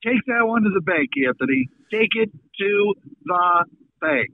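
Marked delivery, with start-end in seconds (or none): 1.9-4.3 each word spaced out for emphasis. Acted. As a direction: land "Take it to the bank" word by word. Repeats promise slowly, word by word.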